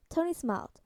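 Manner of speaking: ordinary delivery, nothing marked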